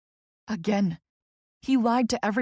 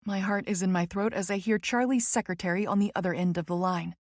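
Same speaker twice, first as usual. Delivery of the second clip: ordinary delivery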